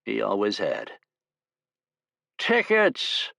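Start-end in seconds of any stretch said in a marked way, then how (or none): 2.4-3.3 put-on slurred drunken conductor call; drunken shout. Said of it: "a drunken voice shouted".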